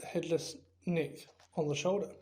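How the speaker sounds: plain reading voice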